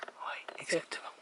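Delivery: slow whispered confirmation; hushed, drawn-out yes; whispering. Real, not acted. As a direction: whisper agreement while watching birds. Confirming in the bird hide.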